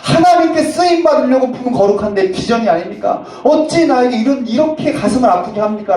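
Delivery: loud, anguished mock wail of a pastor; hammed-up tearful complaint to God; crying out to God. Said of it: "하나님 앞에 아이처럼 엉엉 울었다".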